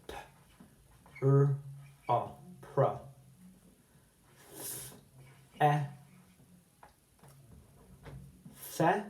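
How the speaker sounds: professor split into letters and syllables; breaking down. Shown as a demonstration. Teacher breaking professor into sounds. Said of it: "Let's break it down".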